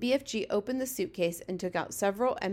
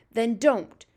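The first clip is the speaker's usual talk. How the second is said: curt, louder retort; sharp; 2 semitones higher, 7 dB louder than usual. A narrator's reading. Giant scolds the girl for making noise, sharp. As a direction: cut the excuse short. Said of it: "answered sharply".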